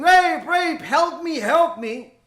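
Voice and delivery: retold scream for help; mock scream for help. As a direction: shout it like a girl in danger. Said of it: "coming out of that car screaming".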